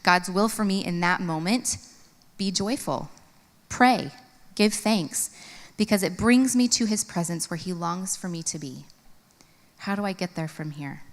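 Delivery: normal talking voice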